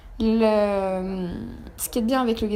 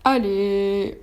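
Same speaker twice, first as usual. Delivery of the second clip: normal talking voice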